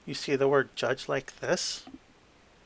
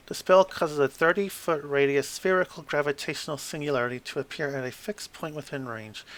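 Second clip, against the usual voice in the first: usual speaking voice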